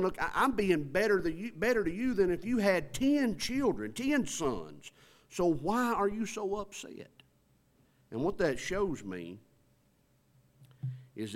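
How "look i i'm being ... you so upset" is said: a frustrated husband asking why she still grieves; exasperated husband. Retold or imitated. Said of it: "he's thinking in his mind".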